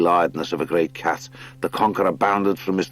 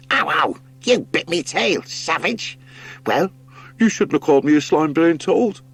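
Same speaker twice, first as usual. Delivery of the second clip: high, whiny bickering rat voices; squabbling sea rats; 10 semitones higher than usual. Acted. Two sea rats quarrelling in the passage, high and whiny. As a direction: yelp and complain about the bite.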